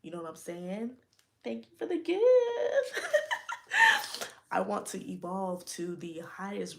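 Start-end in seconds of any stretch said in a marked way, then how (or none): 1.4-2.2 delighted thanks. Real, not acted. Reacting to a gift. 2.2-4.5 bright delighted laugh, high and loud; sudden happy laugh at a gift; high burst of laughter. Real, not acted.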